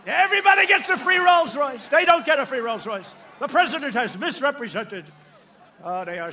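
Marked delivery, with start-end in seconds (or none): 0.1-1.9 belted out higher and much louder, mocking; sarcastic shout. The joke shouted again to the rally, mock outrage. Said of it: "I say sometimes jokingly, sarcastically". 1.9-5.0 loud sneering newsreader parody; mock the pundits, louder; mocking CNN. Mocking CNN's correction to the cheering crowd, loud. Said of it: "to which C N N says".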